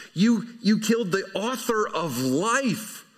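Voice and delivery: slow pointed accusation, lightly acted; accusing scold. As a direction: lay the blame on them, deliberately. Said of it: "don't think you read the inflection here that way".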